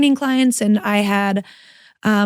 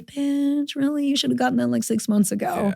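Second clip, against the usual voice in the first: self scolding; 5 semitones higher, 5 dB quieter than usual. Real, not acted. Scolding herself after the excuse.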